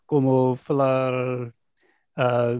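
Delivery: ordinary talk, nothing marked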